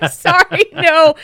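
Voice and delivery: loud laughing no; laughing refusal. Real, not acted. Asked to be a Vikings fan, a laughing no. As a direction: laugh it off, firmly.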